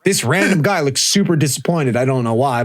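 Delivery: normal talking voice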